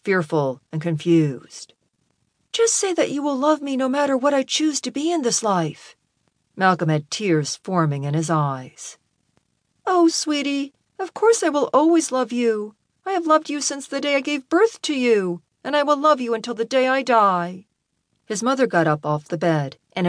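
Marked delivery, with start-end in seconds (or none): 2.5-6.0 high, pleading voice on the edge of tears; earnest son, pitched well up; tearful pleading son. A narrator's reading. 9.9-17.6 high, tender motherly voice; devoted mom, pitched well up; loving mother. A narrator's reading.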